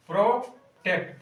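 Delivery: प्रोटेक्ट said slowly, pausing after प्रो before टेक्ट; syllable by syllable. Shown as a demonstration. Teacher to pupils: slow syllable-split प्रोटेक्ट. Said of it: "जहाँ वावेल है वहीं पर उच्चारण कीजिए".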